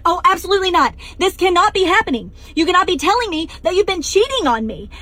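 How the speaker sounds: high loud upset refusal; upset outburst. Retold or imitated. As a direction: refuse to believe it, rising anger. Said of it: "And I am so upset".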